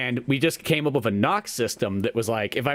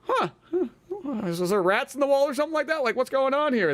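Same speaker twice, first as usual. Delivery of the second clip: puzzled classmates; 10 semitones higher than usual. Acted.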